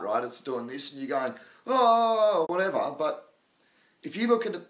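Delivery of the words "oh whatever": mock dismissive "oh whatever", jumping higher and louder; dismissive shrug. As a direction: toss it off like a bored trader. Mimicking a dismissive short-term trader.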